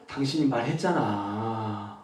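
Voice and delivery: low, slow and hushed, drawing out the final 잖아; soft, stretched ending. Shown as a demonstration. Coach demonstrating gentler speech, soft drawn-out ending. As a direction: let 말했잖아 trail long and gentle. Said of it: "마지막 말을 조금만 늘려주면 되게 부드러워져요".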